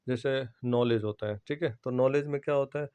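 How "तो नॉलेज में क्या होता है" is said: normal talking voice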